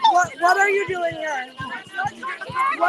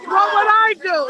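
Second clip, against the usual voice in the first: louder, pushing back; loud defiant challenge; 8 dB louder than usual. Real, not acted. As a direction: throw the question back hard.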